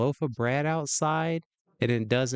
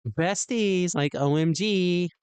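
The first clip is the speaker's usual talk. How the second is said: higher mock Valley Girl; Valley Girl accent; 5 semitones higher, slower than usual. User echoing the assistant's shout-out, mock Valley Girl accent. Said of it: "in a Valley Girl accent".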